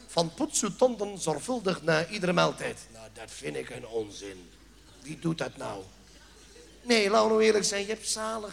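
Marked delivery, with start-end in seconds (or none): 2.9-5.8 much lower and softer grumble; low muttered complaint; muttered aside. Acted. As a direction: grumble it under your breath. Muttered aside about the notice.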